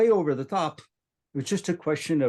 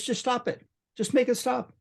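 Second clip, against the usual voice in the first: put-on gentle voice to a child; 12 semitones higher than usual. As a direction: coax a frightened child. Coaxing the girl to stop bleeding.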